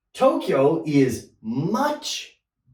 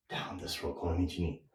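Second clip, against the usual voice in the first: Down this road whispered, much softer; 9 semitones lower, 13 dB quieter than usual. Shown as a demonstration. Teacher echoing the phrase to students in a whisper. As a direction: repeat Down this road as an unvoiced whisper.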